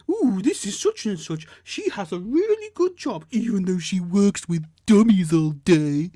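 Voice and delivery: exaggerated high, loud, slow silly-woman voice; mocking advert woman. Man mimicking the stupid woman from an advert, mocking. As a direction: mimic her with drawn-out, swooping gushing. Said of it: "She's like".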